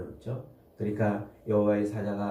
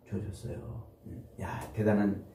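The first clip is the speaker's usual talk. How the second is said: usual speaking voice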